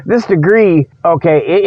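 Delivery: usual speaking voice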